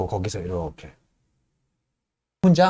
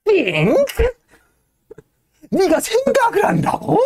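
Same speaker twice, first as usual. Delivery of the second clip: very high, slow jeering sing-song; mock him in a sing-song; mocking chorus; slower than usual. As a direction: crew jeering in unison. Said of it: "뭔지 모를 소리로 다 같이 합창을 합니다".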